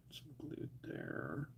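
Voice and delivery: slow, under-the-breath whisper. Real, not acted. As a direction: whisper it while concentrating. Whispered while working.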